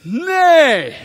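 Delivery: loud, sharp no; firm no. Acted. Preacher loudly refusing his complaining body. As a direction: slam the door on it.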